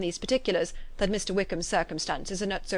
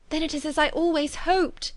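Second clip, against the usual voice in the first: higher-pitched, excited relief; hopeful cry; 7 semitones higher than usual. A narrator's reading. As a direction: exclaim as a hope comes true. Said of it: "cried Jane".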